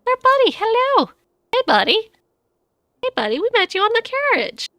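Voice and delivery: overjoyed to see a buddy again; excited greeting. Real, not acted.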